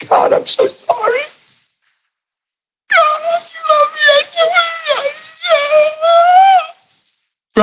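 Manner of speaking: tiny, very high squeaky voice; squeaky mock-emotional plea to God; imitated tearful prayer. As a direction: mimic a high, thin whimpering prayer. Acting out the girl's inner prayer.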